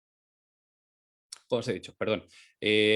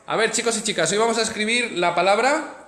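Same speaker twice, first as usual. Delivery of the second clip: teacher voice to class; 8 semitones higher, 6 dB louder than usual. Real, not acted. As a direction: call the class to attention, lively. Teacher talking to class on video.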